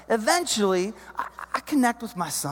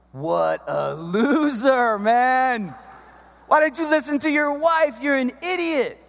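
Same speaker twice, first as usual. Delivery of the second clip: mocking bystanders; 5 semitones higher, slower than usual. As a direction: sneer at the loser on the sand. Imagined beach crowd mocks him.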